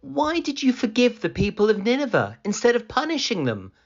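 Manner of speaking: high, quick, puzzled Jonah voice; questioning Jonah. A narrator's reading. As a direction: Jonah asking God why he forgave Nineveh.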